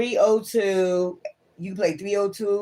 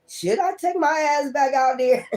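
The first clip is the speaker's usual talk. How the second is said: high mock worried question; 8 semitones higher than usual. Voicing doubtful querent.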